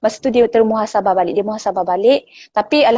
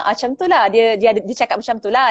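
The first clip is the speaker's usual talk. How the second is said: usual speaking voice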